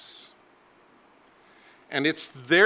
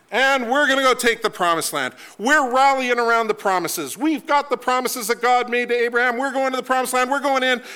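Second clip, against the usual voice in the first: eager rallying cry; 8 semitones higher than usual. Retold or imitated. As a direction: pump them up for the promised land. Israelites rallying, mock excitement.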